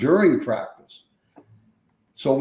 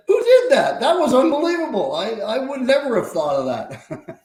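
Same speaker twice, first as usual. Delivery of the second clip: imitating the owner, much higher and laughing through it; howling with laughter; 11 semitones higher than usual. The owner covered in baby powder laughs at the prank. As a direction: cackle it out, delighted by the prank. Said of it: "He just started howling".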